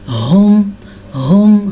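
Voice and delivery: हुम with a breathy chest ha. Shown as a demonstration. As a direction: push the ha from the chest. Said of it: "हा के मखरज को अपनी छाती से निकालना है". (हुम, हा छाती से)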